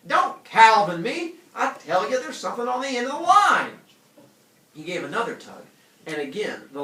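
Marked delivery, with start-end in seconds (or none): 0.1-3.8 angry shout. Acted. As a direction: snap back at her. Calvin snapping at Dora.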